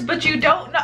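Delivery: normal talking voice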